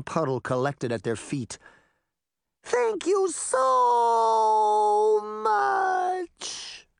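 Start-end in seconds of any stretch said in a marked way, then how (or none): 2.6-6.9 much higher, louder, drawn out very slowly; the witch thanking them as she melts; melting witch cry. Acted. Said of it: "the witch cried".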